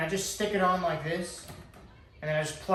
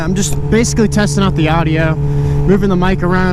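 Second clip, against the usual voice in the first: inside a helmet; 14 dB louder than usual. Real, not acted. Riding moto vlog in helmet mic.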